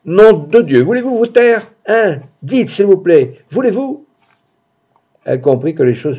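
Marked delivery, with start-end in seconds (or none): 0.1-4.1 high-pitched, exasperated yelling; furious vociferation. A narrator's reading. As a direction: shout it right in their face, pitch climbing. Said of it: "je lui vociférai dans le nez". (vociférer, hors de soi, voix aiguë)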